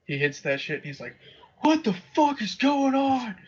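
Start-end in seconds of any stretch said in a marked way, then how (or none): 1.6-3.4 high-pitched mock panic; freaking out. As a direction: imitate a dad suddenly losing it. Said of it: "My dad's freaking out".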